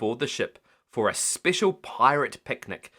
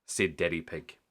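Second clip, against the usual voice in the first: plain reading voice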